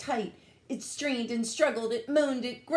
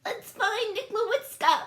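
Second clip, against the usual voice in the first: very high eager alien voice; squeaky idea-girl; Bork crying out; 14 semitones higher, 3 dB louder than usual. A narrator's reading. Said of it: "cried Bork".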